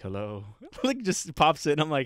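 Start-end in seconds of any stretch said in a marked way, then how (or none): 0.0-0.4 low, soft greeting; hushed, low and eerie; creepy CEO. Retold or imitated. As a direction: greet like a lurking vampire. Imitating the creepy CEO's greeting.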